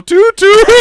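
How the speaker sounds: high, loud train-whistle toot; engine toot. Retold or imitated. Game master imitating the transformed engine's whistle, high toot. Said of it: "the engine lets out a little".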